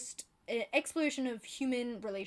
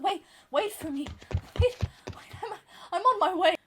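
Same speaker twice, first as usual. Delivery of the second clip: higher, louder pleading to be waited for; mock panicked calling; 8 semitones higher, 10 dB louder than usual. Catching up on hyped books, calling after the crowd in mock panic. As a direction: call out as if running after a departing train. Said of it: "I'm like".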